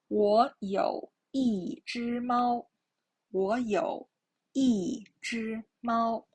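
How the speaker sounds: slow "我有一只猫" with "一" falling; teacher's slow reading, falling tone on "一"; fourth-tone model. Shown as a demonstration. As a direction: drop "一" clearly in "一只猫". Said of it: "is the fourth tone".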